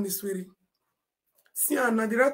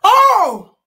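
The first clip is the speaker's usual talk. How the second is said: higher, louder exclamation; startled cry; loud surprised oh; 8 semitones higher, 13 dB louder than usual. Real, not acted. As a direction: burst out in surprise. Sudden exclamation.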